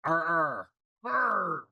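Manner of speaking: a light pirate growl. Pretend. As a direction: toss off a quick pirate arr. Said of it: "We can all talk like pirates".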